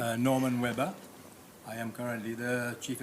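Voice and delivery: loud, projecting across the room; speaking up. Real, not acted. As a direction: project so the far end hears. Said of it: "speak as loudly as you can".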